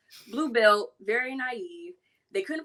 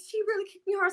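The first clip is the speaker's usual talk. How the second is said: put-on high, tearful-sounding kid whine; an eleven-year-old complaining to her master, high and whiny; whiny kid complaint; 10 semitones higher, faster than usual. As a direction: whine it like a hurt child.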